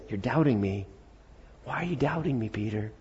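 soft, hushed reproach; gentle correction. Acted. Gentle Jesus, against the shouted take.

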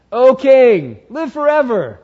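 put-on high, loud proclaiming old Daniel; Daniel's greeting. Daniel calling up to the king from the lions' den, high and proclaiming.

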